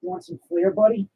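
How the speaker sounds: squeaky high-pitched pet voice; pet talk. Real, not acted. Talking to his pet.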